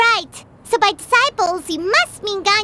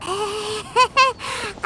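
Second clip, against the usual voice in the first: nervous laugh; 4 dB quieter than usual. Acted. Nervous greeting a stranger.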